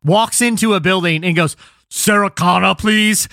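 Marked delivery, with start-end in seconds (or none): 1.9-3.3 put-on stiff Austrian-accented robot assassin; Austrian bodybuilder. Robot assassin walks into a building asking for someone, Austrian accent. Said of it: "a six-foot-two Austrian bodybuilder".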